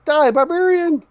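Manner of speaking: high, loud snarling war cry; goblin battle cry. Acted. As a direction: yell it like a charging goblin.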